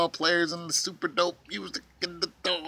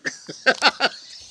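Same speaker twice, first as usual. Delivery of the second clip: breathy high laugh; 10 semitones higher, 5 dB louder than usual. Real, not acted. Laughs again.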